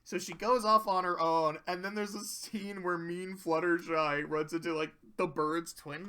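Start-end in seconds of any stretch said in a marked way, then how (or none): none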